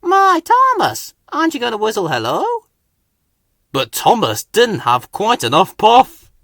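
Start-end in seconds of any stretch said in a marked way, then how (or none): 0.0-2.4 teasing Hank. Acted. Hank teases Thomas.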